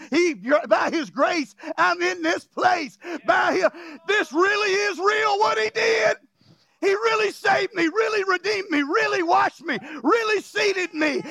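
high, shouted, almost crying; impassioned preaching. Acted. Preacher voices the saved believer, shouting and near tears.

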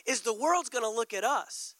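ordinary talk

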